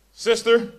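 a preacher mocking a creepy compliment to a sister; mock sensual line. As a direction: croon it like a bad pickup line. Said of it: "These are things you do not say".

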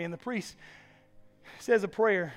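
ordinary delivery

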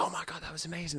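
quick, high, breathy gush; high and quick, like a thrilled fan; gushing fan praise. Retold or imitated. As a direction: imitate a fan rushing to compliment. Retelling fans' praise at the gig.

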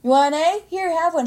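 high, loud mock exasperation; exasperated offer. Teacher offering the complaining mom an A, exasperated. Acts teacher pushing grade on mother.

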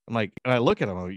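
normal talking voice

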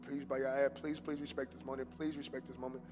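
ordinary delivery

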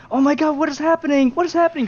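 shrill-voiced bystanders in mock panic. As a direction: ham up the friends freaking out. Friends panicking during fake kidnapping.

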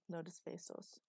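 usual speaking voice

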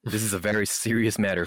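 ordinary talk

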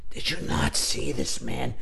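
slow, low, soft rebuke; measured, hushed challenge; stern acting teacher. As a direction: imitate a coach quietly pressing a student. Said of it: "Bob would go".

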